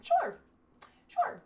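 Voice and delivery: loud, very high-pitched yes; exaggerated agreement. Woman agreeing to extend her stay, exaggerated agreement. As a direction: squeak it out in mock willingness.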